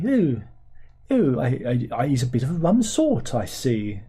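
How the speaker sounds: lightly voiced plummy English accent. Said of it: "They're very plummy".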